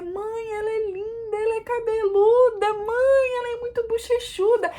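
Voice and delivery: high, tender gushing praise; doctor gushing. Retold or imitated. Doctor telling the mother how lovely the baby is, high and gushing. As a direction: coo over the newborn. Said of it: "E dizia".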